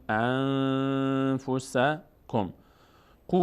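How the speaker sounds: "انفسکم" with a long hummed n, "قو" drawn out; nasal hold. Shown as a demonstration. Teacher showing the sound kept in the nose.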